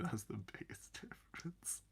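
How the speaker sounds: soft whispered punchline; joking whisper. Real, not acted. Teasing the viewer about the difference, whispered. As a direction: whisper it as a playful tease. Teasing joke after laugh.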